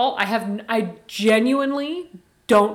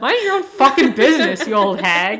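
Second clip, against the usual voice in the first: put-on louder, laughing slogan shout, spat at an old hag; mock angry yell; 7 dB louder than usual. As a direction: bark it out like a t-shirt slogan, half laughing. Repeating it as merch slogan.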